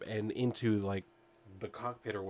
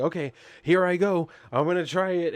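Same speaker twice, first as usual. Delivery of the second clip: raised, louder, psyching yourself up; eager attempt; 7 semitones higher, 6 dB louder than usual. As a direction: play a mock-eager hero about to try. Hero about to try magic word.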